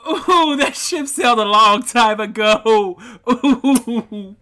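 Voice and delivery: laughing through the words. Retold or imitated. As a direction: crack up while saying it. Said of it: "And I'm like".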